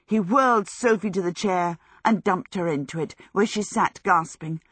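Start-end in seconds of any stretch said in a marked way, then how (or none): none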